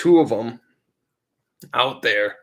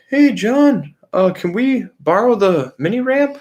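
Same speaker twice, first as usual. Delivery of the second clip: high, put-on twangy neighbor voice; hillbilly neighbors; 9 semitones higher, 4 dB louder than usual. Neighbors asking John to borrow his ramp, high mock voice. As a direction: play the dopey neighbor asking nicely.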